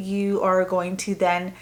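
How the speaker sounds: ordinary delivery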